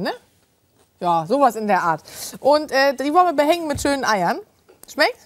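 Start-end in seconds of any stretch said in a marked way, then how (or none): none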